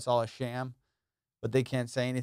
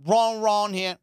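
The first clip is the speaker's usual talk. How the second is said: put-on Jamaican accent; 6 semitones higher, 5 dB louder, slower than usual. Continuing Jamaican impression.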